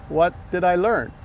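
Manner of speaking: ordinary talk, nothing marked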